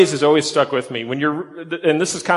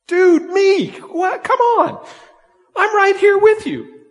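much higher, loud, pleading disbelief; exasperated Ruth; 5 dB louder, slower than usual. Retold or imitated. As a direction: plead like a friend who can't believe she's ignored. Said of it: "I almost picture Ruth on the sidelines going".

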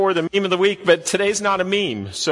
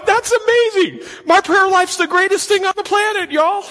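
mock triumphant glee; 3 dB louder than usual. Pastor mocking his own smug prayer to the congregation, hammed-up glee. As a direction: crow over your own prayer, tongue in cheek. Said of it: "I was going".

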